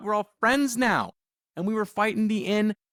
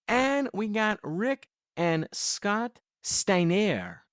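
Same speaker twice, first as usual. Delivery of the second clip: slow, drawn-out names with mock French flourish; French flair; 3 semitones higher, much slower than usual. Co-host announcing the tag team, slow mock-French flourish. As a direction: give "Steiner" a slow playful French ending. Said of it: "with a little French flair there".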